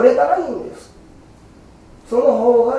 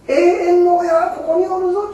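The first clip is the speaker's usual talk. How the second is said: higher, fuller voice of Amida; Amida's firm declaration; 9 semitones higher than usual. Acted. Preacher repeats Amida's promise, higher and fuller. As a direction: proclaim the promise outright. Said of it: "阿弥陀様が".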